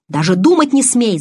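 громкий гневный окрик; запрети криком, повыше. A narrator's reading. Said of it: "закричал Эдвард". (гневно крича)

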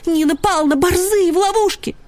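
high, pleading cry; desperate high-pitched plea; urgent alarm. A narrator's reading. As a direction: raise the alarm in a high little voice. Little dog urgently pleads for help.

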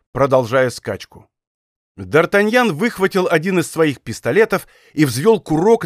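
plain reading voice

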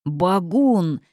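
one slow bog-monster word; gurgling swamp creature. A narrator's reading. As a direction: blurt it out like a bog beast. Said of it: "булькнул он".

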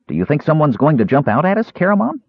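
high, quick and eager, rising at the end; eager question. A narrator's reading. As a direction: ask it like an excitable little kender. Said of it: "Earwig asked eagerly".

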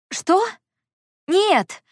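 high-pitched startled protest; alarmed denial. A narrator's reading. Heroine hurries to reassure Anton, alarmed denial. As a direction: jump up in pitch and deny it. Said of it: "Я поспешила его успокоить".

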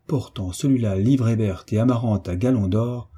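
usual speaking voice

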